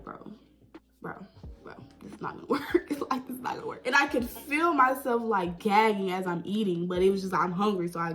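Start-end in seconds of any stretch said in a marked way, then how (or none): 0.0-3.9 very quiet, voiceless aside to self; hushed retold grumble; muttered disbelief. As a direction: whisper it under your breath. Muttering to self while queasy.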